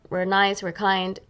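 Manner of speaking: ordinary talk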